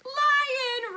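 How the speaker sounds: loud, high mock-alarmed cry; dramatic high-pitched reveal; startled reveal. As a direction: shout the big surprise.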